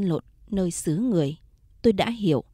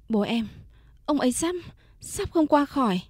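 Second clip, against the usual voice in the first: panicked stammer; 5 semitones higher than usual. Acted. Tells Phong father is dying.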